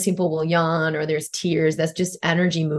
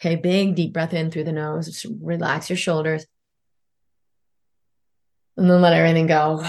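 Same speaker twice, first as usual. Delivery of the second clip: soothing massage murmur. Acted. Leads relaxation after tapping.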